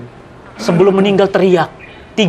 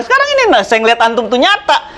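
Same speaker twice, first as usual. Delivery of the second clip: normal talking voice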